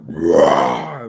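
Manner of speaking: low, loud beast roar; deep loud animal roar; mock roar. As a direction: roar like a wildebeest. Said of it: "I was like".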